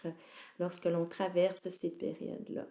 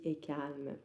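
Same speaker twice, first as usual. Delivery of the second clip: ordinary talk, nothing marked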